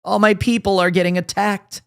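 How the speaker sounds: mock her tearful apology; hammed-up tearful imitation; mock sobbing. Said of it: "she sobbed".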